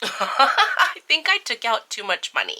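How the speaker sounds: laughing through the words, loud and bright; embarrassed laughing admission. Real, not acted. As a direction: giggle out the confession. Laughing at own money mistake.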